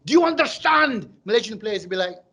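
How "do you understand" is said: hammed-up Scottish coach shout. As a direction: bellow it in a thick Scottish accent. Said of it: "When the coach said".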